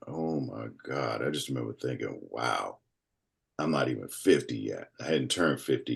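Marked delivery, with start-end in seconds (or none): 0.1-4.9 quiet, inward disbelief; hushed retelling of shocked thoughts; stunned. Retold or imitated. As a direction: let it sink in, softly. Stunned thoughts after stroke news.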